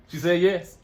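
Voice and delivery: high, loud happy shout; excited shout. Real, not acted. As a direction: shout the news with joy. Announcing to camera.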